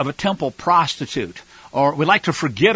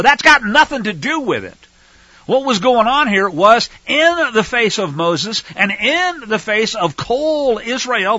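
usual speaking voice

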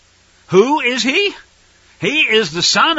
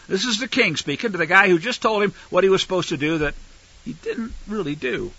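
usual speaking voice

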